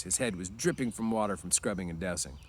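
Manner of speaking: plain reading voice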